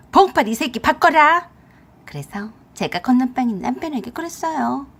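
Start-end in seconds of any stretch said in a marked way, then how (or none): 0.1-1.5 loud, high angry demand; furious mother-in-law demanding her son. A narrator's reading. Mother-in-law ordering her son onto the phone, furious. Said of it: "어머니가 화가 많이 나셨네".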